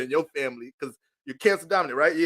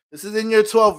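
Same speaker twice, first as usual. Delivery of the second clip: ordinary talk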